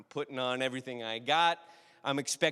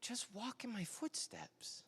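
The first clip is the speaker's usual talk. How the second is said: gentle grandpa; 7 semitones higher, 17 dB quieter than usual. Retold or imitated.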